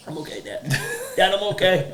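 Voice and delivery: put-on a little girl insisting to her dad she's fine. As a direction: imitate a small daughter holding back tears. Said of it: "And she's like".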